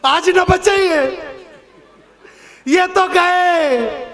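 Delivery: wife wailing as husband lifts the bride; mock despairing wail. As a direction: drag out the wife's hopeless wail.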